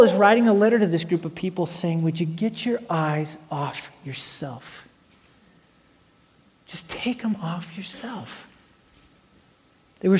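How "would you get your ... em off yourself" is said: slow, soft, near-whispered appeal; hushed plea. Acted. Paul pleading with his church to look away from themselves, hushed. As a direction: drop to a slow, intimate hush.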